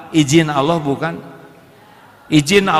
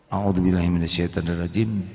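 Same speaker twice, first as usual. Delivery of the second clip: softly; 8 semitones lower, 5 dB quieter than usual. Shown as a demonstration. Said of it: "Pelan, ya".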